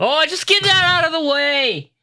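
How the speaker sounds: high, loud put-on Australian accent; hammed-up Aussie guard. Australian guard scolding a parked truck, high and loud Aussie accent. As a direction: bark it like a cartoon Aussie bloke.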